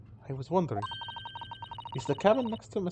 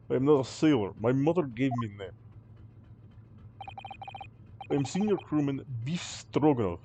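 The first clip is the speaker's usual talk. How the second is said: put-on proud sailor in a Russian accent; 4 semitones lower than usual. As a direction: correct them with stiff Russian pride. Sailor insists on his name.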